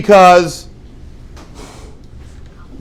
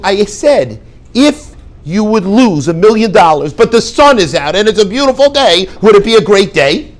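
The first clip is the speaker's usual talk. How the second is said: louder and higher, pressing the point; exasperated loud insisting; 7 semitones higher, 8 dB louder than usual. Retold or imitated. The student presses the rebbe again, exasperated loud insisting. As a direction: spell it out loudly like he's not getting it.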